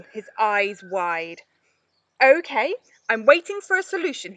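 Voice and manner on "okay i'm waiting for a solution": impatient challenge. A narrator's reading. Ollie challenging impatiently, loud and fast.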